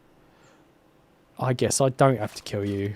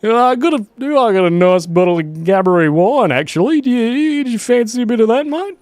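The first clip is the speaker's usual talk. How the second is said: high, loud hammed-up Aussie drunk; stupid Aussie voice; 9 semitones higher, 5 dB louder than usual. Streamer voicing a drunk Aussie character to chat, hammed-up Aussie accent. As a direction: play a rowdy Aussie bloke looking for a drinking mate. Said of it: "put on stupid voice".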